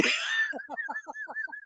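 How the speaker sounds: high, soft wheezy laugh. Real, not acted. Angler laughing at his big-head joke, high wheezy laugh.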